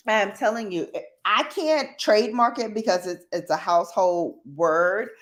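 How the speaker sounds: usual speaking voice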